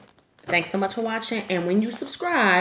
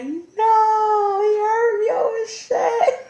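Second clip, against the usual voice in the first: higher, louder, cry-laughing wail; mock embarrassed wail; 5 dB louder, much slower than usual. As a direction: whine in fake humiliation.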